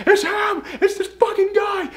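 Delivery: high shrill-pitched mock scream of panic; mock hysterical scream. Woman spotting her stalker, a hammed-up scream. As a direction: shriek it like a panicked patient. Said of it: "she's just screaming".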